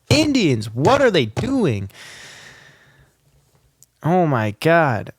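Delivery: loud high outburst; shouting. Real, not acted. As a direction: yell at the noise outside. Shouting at noise outside.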